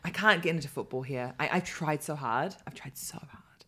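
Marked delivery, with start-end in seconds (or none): 2.6-3.6 soft whispered aside; whispering. Acted. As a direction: whisper it sadly to yourself. Rueful whispered repeat.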